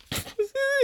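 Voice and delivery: high, stifled burst of giggles; imitated sudden laughter. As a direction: act out cracking up, high-pitched. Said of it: "and starts laughing".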